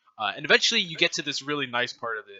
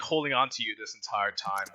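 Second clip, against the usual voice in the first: ordinary talk, nothing marked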